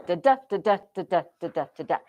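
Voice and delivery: five pairs of "da", weak then strong; bouncing even beat on each "da", second one hit; iambic beat. Shown as a demonstration. Teacher demonstrating the next line of meter, steady weak-strong beat. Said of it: "Then the next line".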